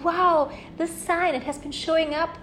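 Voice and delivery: loud, high excited exclamation. Retold or imitated. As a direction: burst out in delight. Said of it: "you were saying".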